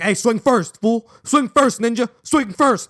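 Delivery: mock tough guy egging on a fight; street fight goading. YouTube street fighter to rival, imitated goading call. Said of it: "they're always like".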